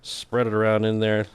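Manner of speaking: ordinary talk, nothing marked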